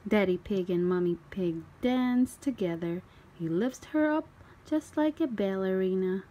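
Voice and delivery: usual speaking voice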